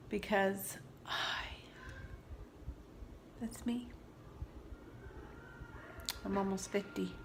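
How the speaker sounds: soft unvoiced admission; vulnerable whisper. Real, not acted. Mother confessing to viewers about her face, whispering. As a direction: let it slip out in a whisper.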